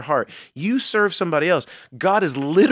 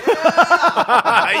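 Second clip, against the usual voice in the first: laugh; 13 semitones higher, 6 dB louder than usual. Real, not acted. Laugh after outro gag.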